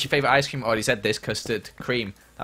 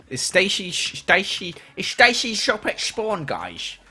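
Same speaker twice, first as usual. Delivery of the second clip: put-on odd accent with every S turned to a slushy SH, pitched up; slurred mock accent; 8 semitones higher, 5 dB louder, slower than usual. Streamer asking viewers for Stacy's shop, in a silly slurred accent. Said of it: "I don't know what that accent was".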